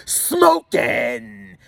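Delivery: hammed-up high, drawn-out catchphrase shout; Jim Carrey. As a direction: belt it out like a cartoonish movie catchphrase. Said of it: "fucking mask like Jim Carrey".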